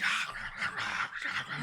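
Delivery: mock crowd cheering, hushed and breathy; faint mock audience chant; imitated crowd roar. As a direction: ham up a distant crowd going rah rah.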